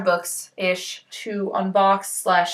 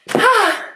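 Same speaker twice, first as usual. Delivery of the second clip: high, sharp puff of breath; effortful sigh; 9 semitones higher, 4 dB louder than usual. Real, not acted. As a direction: heave out a sigh after lifting. Puts down heavy box.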